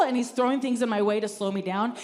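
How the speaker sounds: usual speaking voice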